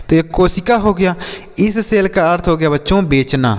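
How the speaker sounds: ordinary talk, nothing marked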